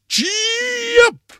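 very high, bellowed driving call; loud shout. A narrator's reading. Grandpa driving his bed like a coach, shouting. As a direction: bellow it like a bull with a megaphone. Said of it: "I heard Grandpa shout".

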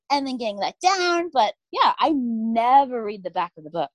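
ordinary delivery

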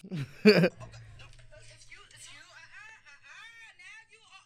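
long high-pitched laugh. Real, not acted. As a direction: burst into a long squealing laugh. Laughs at stoner problems.

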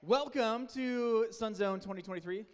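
loud, slow and high, announcing it to the room; big hyped welcome. Shown as a demonstration. Camp leader welcoming volunteers, loud and slow. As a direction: belt it out like a camp host opening the week. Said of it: "let's try one more time".

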